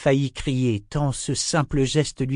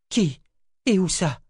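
alarmed questions; 9 semitones higher, 3 dB louder than usual. A narrator's reading. Urgent questions.

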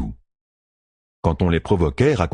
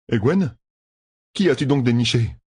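lightly voiced young man, higher; ask, puzzled; a hint of a curious young husband; Gawain's voice; 6 semitones higher than usual. Gawain questions his wife.

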